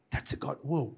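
ordinary delivery

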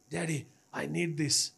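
usual speaking voice

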